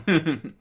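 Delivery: loud brief laugh; quick laugh; short laugh. Real, not acted. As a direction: burst out laughing.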